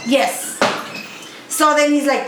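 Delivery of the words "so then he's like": ordinary delivery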